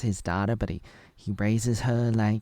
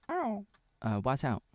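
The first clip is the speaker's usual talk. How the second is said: ordinary talk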